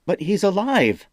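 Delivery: high, loud protest; yell. A narrator's reading. Jaden protests his general's funeral, loud yell. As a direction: cry out in disbelief. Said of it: "Jaden yelled".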